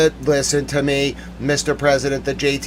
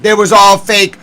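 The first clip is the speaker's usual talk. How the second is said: raised, loud insistence; pissed; 5 semitones higher, 7 dB louder than usual. Real, not acted. Telling listeners it was faked, pissed off. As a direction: insist on it in outrage. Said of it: "I'm pissed".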